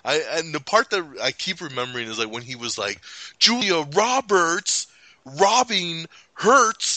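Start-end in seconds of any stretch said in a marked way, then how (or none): 3.4-7.0 slow, high dramatic poetry recital; mock slam poet. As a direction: ham up a slam poem line, drawn out and high.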